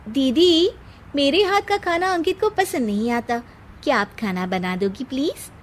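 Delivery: high, sugary voice of a scheming wife; sweet-talking sister-in-law. Acted. Seema coaxes Anu into cooking, high and sweet. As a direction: coax the older sister, high and honeyed.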